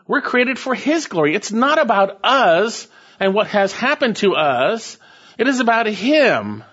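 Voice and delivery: high, ringing preacher's proclamation; preacher lifting his voice over the crowd; fervent preaching. Acted. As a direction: proclaim it to the congregation with full force. Preaching with rising fervor.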